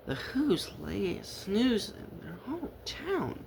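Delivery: plain reading voice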